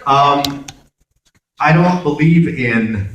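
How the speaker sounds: ordinary talk, nothing marked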